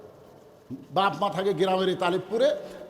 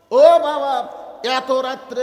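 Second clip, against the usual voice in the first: loud high "ও বাবা" of surprise; surprised cry; 4 semitones higher, 7 dB louder, much slower than usual. Acted. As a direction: exclaim as if shocked to see him. Son greets father in surprise.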